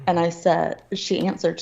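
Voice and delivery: ordinary talk, nothing marked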